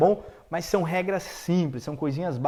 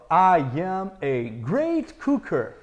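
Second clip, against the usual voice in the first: slow demo; much slower than usual. Shown as a demonstration. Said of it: "A frase é I am".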